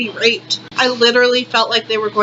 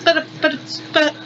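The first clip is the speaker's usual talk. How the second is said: stammering cashier; 5 semitones higher, 3 dB quieter than usual. Retold or imitated. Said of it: "he's like".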